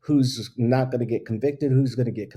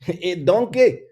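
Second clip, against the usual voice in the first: higher, louder, drawn-out cartoon ogre impression; Shrek; 6 semitones higher, 6 dB louder, slower than usual. Podcaster recalling a film line, hammed-up Scottish ogre. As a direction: belt it out in a mock Scottish accent. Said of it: "only thing I can remember from Shrek".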